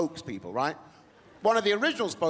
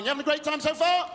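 hyping crowd; 10 semitones higher, 3 dB louder than usual. Real, not acted. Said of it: "asks audience".

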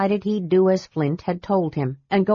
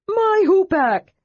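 high, slow outraged cry; as if your stick was stolen; indignant cry; 12 semitones higher, 4 dB louder, slower than usual. A narrator's reading. Tass spotting Armin holding his hoopak, indignant cry. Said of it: "Tass cried indignantly".

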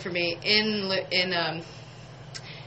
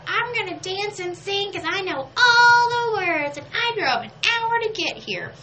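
high, giddy fan voice, singing along to music; excited fan; 13 semitones higher, 4 dB louder than usual. Retold or imitated. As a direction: play the thrilled fan who knows every word. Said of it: "I was just like".

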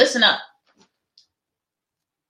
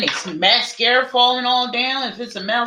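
usual speaking voice

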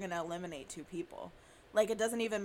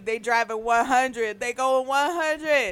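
hyped; 8 dB louder than usual. Pretend. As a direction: proclaim it like a hype man. Hyping the comeback song.

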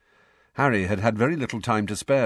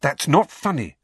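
sharp, higher-pitched reproof; 6 semitones higher, 4 dB louder than usual. A narrator's reading. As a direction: tell them off sharply. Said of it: "said Hermione sharply".

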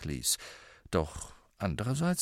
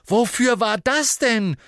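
high, loud bellow; 13 semitones higher, 6 dB louder than usual. A narrator's reading. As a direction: roar the question at him. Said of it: "brüllte Harry".

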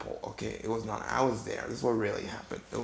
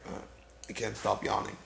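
normal talking voice

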